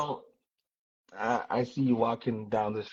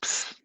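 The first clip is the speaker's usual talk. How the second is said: sharp hissed psst to catch attention; psst call. Shown as a demonstration. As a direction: hiss to get her attention. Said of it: "that's how we holler".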